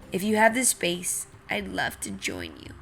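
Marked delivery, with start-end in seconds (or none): none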